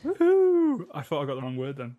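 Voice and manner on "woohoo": high loud cheer; excited whoop. Real, not acted. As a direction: cheer like you won. Word game finished triumphantly.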